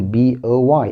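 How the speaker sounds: "بي او واي" as three letter names; name each letter in "بي او واي" separately; spelling letter by letter. Shown as a demonstration. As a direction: spell out "بي او واي", bee, oh, why. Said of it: "تهجيت الكلمة حرف حرف".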